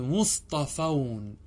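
مصطفون stretched out syllable by syllable; drawn-out مصطفون for students to copy; slow model word. Shown as a demonstration. Grammar teacher modelling the plural form very slowly. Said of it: "فتقول في مصطفى".

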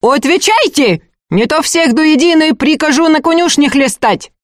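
high, piercing, threatening command; imperious high-pitched threat; thin, cutting voice. A narrator's reading. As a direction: raise your voice into a nasty thin screech of authority. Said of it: "повысила голос, ставший противно тонким и режущим".

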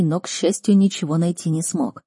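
plain reading voice

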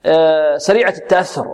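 ordinary talk

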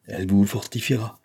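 ordinary delivery

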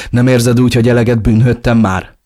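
acted yell, losing control; beside-himself shout. A narrator's reading. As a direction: shout it in exasperation. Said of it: "Ordítottam magamból kikelve".

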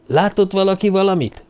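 high, urgent calling out; high shouted question; yelling. A narrator's reading. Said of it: "ordította Cartwright".

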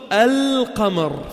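"القمر" with the L clearly held; sounded moon lam. Shown as a demonstration. Teacher showing students the moon lam, L fully sounded in "القمر". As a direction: say "القمر" as al-qamar, lingering on the L. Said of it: "فأنت لفظت اللام".

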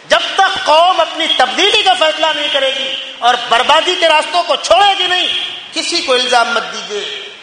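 high, urgent exhortation; forceful preaching. Acted. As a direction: press the congregation hard to act. (پرجوش، بلند سُر میں نصیحت)

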